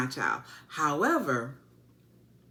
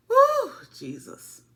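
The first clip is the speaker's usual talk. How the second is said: exclaiming; 5 dB louder, slower than usual. Real, not acted. Exclaiming after heated scene.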